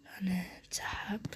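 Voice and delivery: barely audible, breathy hush; hushed whisper. Real, not acted. Hiding from the enemy, hushing his teammates in a whisper. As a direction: keep dead quiet while hiding.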